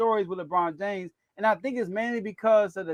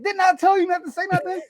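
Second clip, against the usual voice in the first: scolding coach; 10 semitones higher than usual. Retold or imitated. Pat Riley scolding a player.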